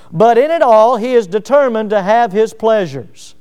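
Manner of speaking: usual speaking voice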